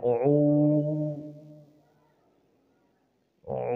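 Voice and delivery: উ pressed with throat force. Shown as a demonstration. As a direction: push the উ from the throat. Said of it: "এভাবে চাপ দিয়ে বলতে হবে".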